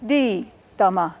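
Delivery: "Di tama" slow, "Di" sliding from high to low; falling pitch. Shown as a demonstration. Teacher repeats "Di tama" showing the falling tone. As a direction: start "Di" high, let it fall. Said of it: "it starts high and goes low".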